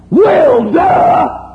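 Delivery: high, loud mocking retort; sarcastic shout; shouted duh. Real, not acted. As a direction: throw it back at the doubters. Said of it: "retort to the doctrine".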